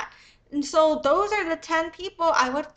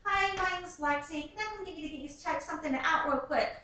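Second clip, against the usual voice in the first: higher, soft, sing-song canvasser patter; fundraiser street pitch; 3 semitones higher, 7 dB quieter than usual. Retold or imitated. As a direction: sweet-talk a stranger into stopping. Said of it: "I'm gonna be like".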